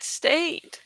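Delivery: lively read-aloud storytelling; story narration. A narrator's reading. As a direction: narrate the adventure with drama. Reads novel aloud.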